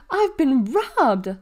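high, loud, drawn-out outcry; hollered. A narrator's reading. Mabel discovering her brownies gone, hollered. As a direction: holler it in outrage, stretched out. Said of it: "she hollered".

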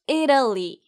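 이탈리 as "EE-duh-lee", moderately held EE; repeat-after-me 이탈리 with flapped T; pronunciation drill. Shown as a demonstration. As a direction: stress the first syllable of 이탈리. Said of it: "그럼 함께 말해볼까요".

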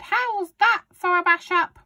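high, loud, boastful granny holler; shouting grandma. A narrator's reading. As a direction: crow it out as Grandma. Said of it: "she shouted".

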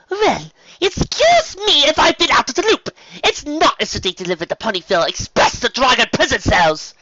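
high, loud indignant sarcasm; huffy. A narrator's reading. Imprisoned Rarity answering Spike's reproach, huffy. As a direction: huff it out, offended and shrill. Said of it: "Rarity huffed".

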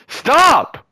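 sudden high yell; yelling stop. Real, not acted. Yelling at a teammate to stop. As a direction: shout to stop him in his tracks. Friend about to fall, yells out.

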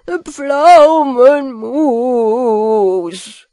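very drawn-out, loud wavering howl; hammed-up loud bawling. A narrator's reading. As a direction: howl it, stretching every vowel. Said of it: "heulte Peter lauter".

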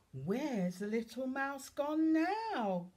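high, loud, slow question; sing-song, stretched-out storytime question; storybook wondering. Acted. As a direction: ask a small child with big curiosity.

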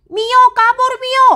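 high, loud call to run; panicked shout. Acted. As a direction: yell a warning to her. Calling out while fleeing.

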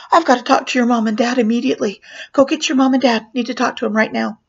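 fast, insistent orders; urgent demand. Retold or imitated. Clown tells children to get their parents, urgent. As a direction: rattle it out with mock urgency.

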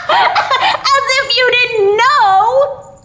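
very high, loud, drawn-out cry through giggles; high giggly exclamation; giggling child. A narrator's reading. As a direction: squeal it slowly like a tickled little boy.

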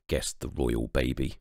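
ordinary delivery, nothing marked

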